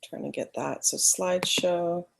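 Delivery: usual speaking voice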